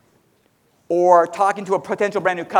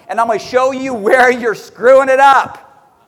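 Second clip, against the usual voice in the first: slow emphatic warning; 2 semitones higher, 4 dB louder than usual. Shown as a demonstration. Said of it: "repeats line".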